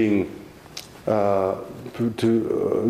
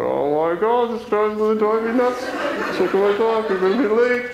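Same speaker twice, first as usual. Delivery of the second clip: much higher, louder, strained through a forced grin; fake-smile panic; 13 semitones higher, 4 dB louder than usual. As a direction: panic while grinning politely at the guard. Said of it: "with a big fake smile on my face going".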